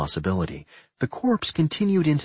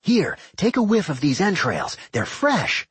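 high, eager character voice; gleeful dungeon character; 8 semitones higher than usual. A narrator's reading. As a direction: offer it up with high-pitched glee. Doc eggs Rowan on.

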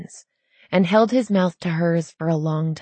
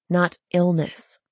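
ordinary delivery, nothing marked

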